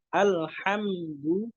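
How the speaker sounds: "Alhamdu" said slowly through a smile, hamza thin; smiling thin sound. Shown as a demonstration. Teacher showing smiling "Alhamdu", slow. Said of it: "Caranya dengan tersenyum".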